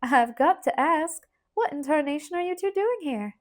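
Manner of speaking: a hint of a peppery Southern aunt; peppery aunt with a mild rural twang. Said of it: "in her peppery twang".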